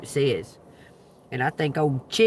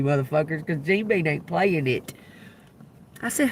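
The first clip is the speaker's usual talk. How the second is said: ordinary talk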